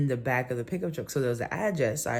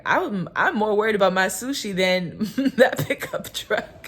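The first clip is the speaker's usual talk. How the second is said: higher, louder, laughing through the words; chuckling neighbour, higher and louder; laughing joke; 8 semitones higher, 8 dB louder than usual. Retold or imitated. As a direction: toss it off as a joke between giggles. Neighbour joking about sushi.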